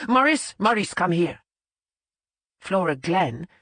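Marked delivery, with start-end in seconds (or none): 0.1-1.4 higher, louder shout across the kitchen; yelling for Maurice. A narrator's reading. Head chef yelling for his pastry chef, mock shout. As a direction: call him over at full voice. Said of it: "yelled at the top of his considerable voice".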